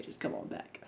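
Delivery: whispered, offhand come on back; mock whisper. Reviewer mocking Bull inviting Cable back, whispered. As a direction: barely voice the invitation.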